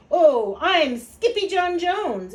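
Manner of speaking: high, loud, slow-stretched kitten boast; boastful kitten. A narrator's reading. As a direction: announce yourself proudly while bouncing. Bouncing kitten announces himself.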